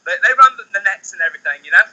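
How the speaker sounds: put-on London accent, hammed up. Shown as a demonstration. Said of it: "love to hear you doing London accent".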